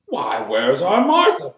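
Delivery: loud, drawn-out question rising high; crying out. A narrator's reading. Bob looking around for his daughter Martha, crying out. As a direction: call out in puzzled search. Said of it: "cried Bob Cratchit".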